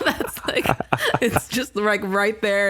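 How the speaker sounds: usual speaking voice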